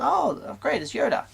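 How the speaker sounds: high-pitched reaction on seeing Yoda; mock delight. Viewer reacting to Yoda's appearance, in high mock delight. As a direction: squeal it lightly, like a thrilled fan.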